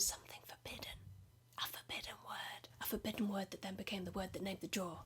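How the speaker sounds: hushed conspiratorial whisper. Pretend. Comedian to audience, a secretive whisper. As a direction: breathe it like a secret. Whispering about the forbidden drawer.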